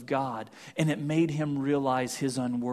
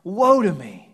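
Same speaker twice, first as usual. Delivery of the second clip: burst of woe; 3 semitones higher, 8 dB louder than usual. A narrator's reading. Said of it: "burst out of him".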